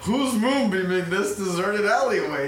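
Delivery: high, loud, drawn-out mock complaint; indignant bystander. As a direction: play an overdramatic outraged passerby. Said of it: "some dude's like".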